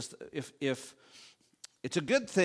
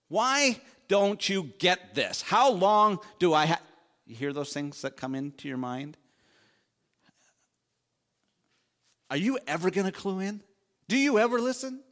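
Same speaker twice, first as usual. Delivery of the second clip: higher and louder, like an impatient spouse snapping; mock exasperated rant; 6 semitones higher, 5 dB louder than usual. Imitated insults at a spouse.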